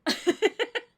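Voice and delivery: very high, loud shrieking laughter; hard laughter. Real, not acted. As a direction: lose it completely laughing.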